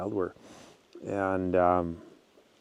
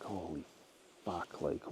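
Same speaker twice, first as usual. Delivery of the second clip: much softer, under the breath; hushed dismay; 3 semitones lower, 15 dB quieter than usual. Retold or imitated. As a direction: mutter it quietly to yourself, retelling your dismay. His stunned reaction in retelling.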